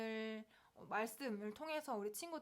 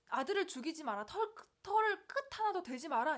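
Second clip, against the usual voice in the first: raised, insistent order to stop; urgent command; 6 semitones higher, 7 dB louder than usual. Acted. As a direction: forbid it firmly, climbing high and loud. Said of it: "급하게 막으세요". (다급하게 말리는 명령)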